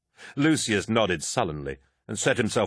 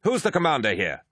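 demanding; 7 semitones higher than usual. Acted. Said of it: "a voice demanded".

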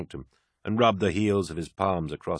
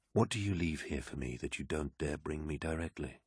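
restrained warlord; 11 dB quieter than usual. A narrator's reading. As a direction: ask the aide quietly, with authority. Narrator voices Horus questioning his aide.

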